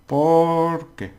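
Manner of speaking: ¿Por qué? with POR loud and high; stress on POR. Shown as a demonstration. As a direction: punch POR, not qué. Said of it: "That means because".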